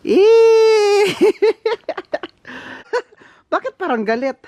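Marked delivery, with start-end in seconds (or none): 0.1-1.1 loud, very high "eee" squeal; piercing drawn-out shriek; long squeal. Real, not acted. Face into cold snow. 1.2-2.3 high-pitched burst of laughter; real high laughter; giggling. Real, not acted. Laughing after the snow squeal.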